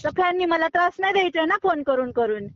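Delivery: ordinary talk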